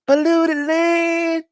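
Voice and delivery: very high, drawn-out put-on voice; mimicking the complainers. Preacher mimicking the murmuring people for laughs, high stretched mock complaint. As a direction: hold it long on one high note. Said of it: "How they say it?".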